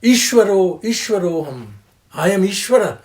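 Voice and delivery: loud boastful claim; mock proud declaration; arrogant boast. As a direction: voice the arrogant man crowing. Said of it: "who says".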